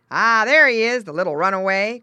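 loud farmer's cry of discovery. A narrator's reading. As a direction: exclaim it on spotting the runaway. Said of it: "cried the farmer man".

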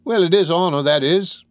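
high, loud Cockney lad perking up; a little consoled. A narrator's reading. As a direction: concede it with returning pride. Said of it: "a little consoled".

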